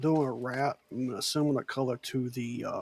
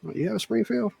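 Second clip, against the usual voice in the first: surprised; 10 semitones higher, 5 dB louder than usual. Real, not acted. Learns she has his rifle.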